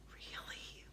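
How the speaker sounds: hushed, barely audible question; whisper. A narrator's reading. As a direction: whisper it very softly. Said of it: "whispered Annie".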